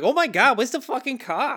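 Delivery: high mock Long Island accent. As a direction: squawk it like a Long Islander in a car. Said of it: "sounded like downtown Long Island".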